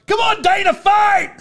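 high-pitched cheering yell at a cousin. Retold or imitated. Retelling a cheer yelled at cousin in the match, yelling. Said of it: "I was, like, yelling at my cousin".

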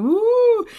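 high, loud rollercoaster whoop; passengers whooping. Retold or imitated. Passengers on a bumpy plane whooping loud and high. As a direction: mimic riders yelling woo on a drop. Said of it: "people are going".

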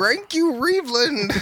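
high dog talking with r's; Scooby-Doo voice. Pretend. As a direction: talk like a cartoon dog. Dog voice replying with R sounds.

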